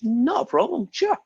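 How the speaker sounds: lightly voiced Polish guy, pitched high; a touch of a high-pitched joke character. Teacher telling a joke, lightly voiced Polish guy agreeing. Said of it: "The Polish guy says".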